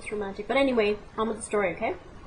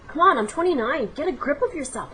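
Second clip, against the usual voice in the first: self-reprimand; 6 semitones higher, 6 dB louder than usual. Retold or imitated. Telling herself off.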